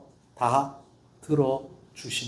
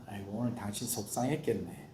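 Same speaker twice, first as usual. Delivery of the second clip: much softer, lower comforting words; husband soothing his upset wife gently; soft sympathy; 6 semitones lower, 14 dB quieter than usual. Shown as a demonstration. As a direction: murmur it tenderly to a hurt wife. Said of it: "뭐라고 얘기해야 되냐면".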